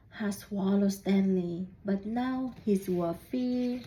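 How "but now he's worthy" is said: usual speaking voice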